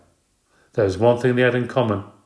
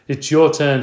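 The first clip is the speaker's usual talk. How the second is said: firm; 5 semitones higher, 6 dB louder than usual. A narrator's reading. Father arguing.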